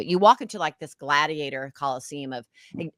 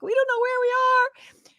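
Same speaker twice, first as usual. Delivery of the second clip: high, slow mock tearful plea; a hint of a sob; retold crying; slower than usual. Crying to policemen when lost, mock tearful high plea. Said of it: "and I'm crying".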